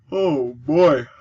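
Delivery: said through a yawn. Real, not acted. Yawning through his game commentary. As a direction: yawn the words out. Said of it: "I am sorry I'm yawning so much".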